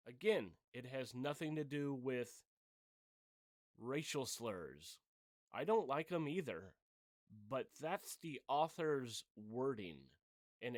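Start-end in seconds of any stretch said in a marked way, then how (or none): none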